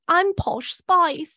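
high, slow British pop-star voice; mock British accent. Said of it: "a British accent the entire show".

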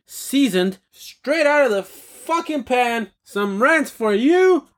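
high, showman build-up, slowing at the end; hype announcer intro. Acted. Opening his rant segment to viewers, hyped announcer. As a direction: sell the rants like a sizzling dish.

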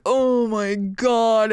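very slow, stretched retold dismay; drawn-out mortification. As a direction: drag each word out in embarrassment. Mortified reaction as stranger passes.

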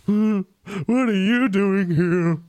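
a big ogre asks who's there; curious ogre. Acted. Game master voicing the ogre who catches the party, curious. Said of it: "turns to look at you guys and is like".

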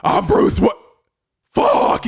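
loud hammed-up superhero voice breaking into a curse; Batman. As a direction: parody hero blurting out his own name. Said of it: "I like the Bat Metal voice".

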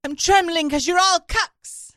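high, loud mock outrage; imitating Greta Thunberg. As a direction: rant like an outraged teen activist. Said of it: "very bad accent".

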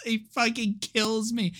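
normal talking voice

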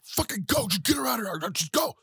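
high-pitched frantic urging; freaking out. Retold or imitated. Retelling Kenobi sending her off while freaking out, high and panicked. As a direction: act out panicked pleading, pitch jumping way up. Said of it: "He actually freaked the fuck out".

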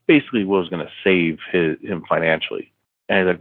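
ordinary delivery, nothing marked

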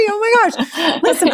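high laughing squeal, mock apologetic; mock-sorry giggle.

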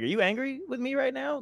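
high, softened timid question; small, high-pitched fearful voice, put on; mock terrified. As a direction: play scared of an angry stranger. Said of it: "and I'm terrified".

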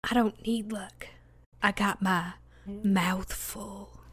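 sly slow whisper. Acted. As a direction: hush it like a secret. Sly reply after good luck.

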